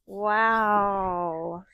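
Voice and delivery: usual speaking voice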